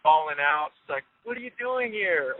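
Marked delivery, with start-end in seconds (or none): 1.2-2.4 shocked disbelief. Retold or imitated. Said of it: "waterworks from there".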